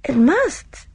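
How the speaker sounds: high, awed exclamation. A narrator's reading. Gina marvelling at Miss Marple's age, awed. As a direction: say it high, struck with wonder. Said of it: "with awe in her voice".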